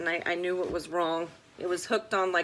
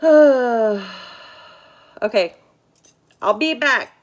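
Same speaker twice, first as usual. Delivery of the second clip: loud, fed-up sigh then words; exasperated loud sigh; 2 semitones higher, 10 dB louder than usual. Real, not acted. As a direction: blow out a frustrated okay. Stream audio failing, exasperated.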